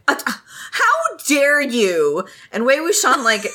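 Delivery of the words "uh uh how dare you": slow, high huffy gasp; hammed-up offended boy; mock offended. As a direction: drag it out like a scandalised noble. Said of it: "he's like".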